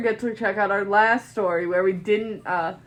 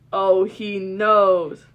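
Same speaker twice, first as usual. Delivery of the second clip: slow dread; 12 dB louder, much slower than usual. Acted. Dread realisation.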